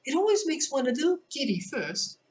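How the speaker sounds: high, lilting Queen; a lightly voiced whimsical queen. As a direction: play the odd queen lightly. Queen dialogue.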